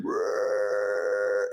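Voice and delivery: drawn-out mock elk bugle; imitating a bull elk's roar; imitated elk bugle. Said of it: "and he's bugling".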